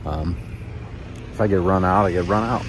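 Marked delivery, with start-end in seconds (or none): none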